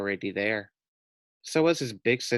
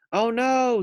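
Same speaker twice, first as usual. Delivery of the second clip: much higher, louder alarm; alarmed boy; 12 semitones higher, 4 dB louder than usual. A narrator's reading. Maxwell seeing the pig drift away, high alarmed. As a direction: cry it out in alarm.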